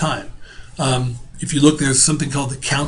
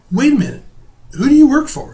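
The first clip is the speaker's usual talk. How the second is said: mock surprise; 10 semitones higher, 4 dB louder than usual. Retelling challenge to neighbor.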